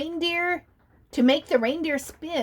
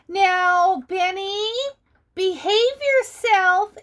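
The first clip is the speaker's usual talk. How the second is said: amused scolding; 6 semitones higher, 4 dB louder, slower than usual. A narrator's reading. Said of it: "Emily laughed".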